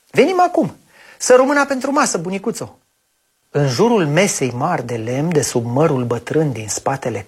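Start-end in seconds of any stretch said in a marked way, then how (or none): 0.1-2.8 put-on eager child voice. Copilul răspunde bunicii.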